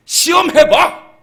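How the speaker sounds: slow, loud furious dare; angry God challenging. Acted. God daring his unfaithful people, loud and slow. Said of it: "하나님이 지금 화가 났다는 얘기예요".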